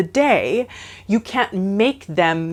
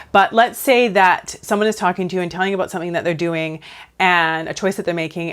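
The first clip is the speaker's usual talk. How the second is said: usual speaking voice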